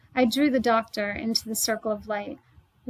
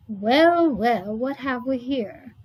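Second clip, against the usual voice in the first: landlady voice; 6 dB louder, slower than usual. A narrator's reading. Landlady confronts Delphine.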